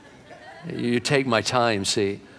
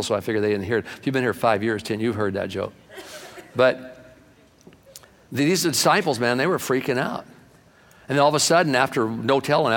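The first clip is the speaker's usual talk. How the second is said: ordinary delivery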